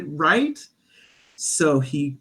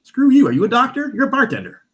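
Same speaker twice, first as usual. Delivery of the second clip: higher, louder retort, clearly angry; 9 semitones higher, 5 dB louder, faster than usual. Retold or imitated. Snapping back at a bartender who says you don't look hurt, angry. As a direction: snap back at him, offended. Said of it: "I'm like".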